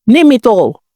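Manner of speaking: ordinary delivery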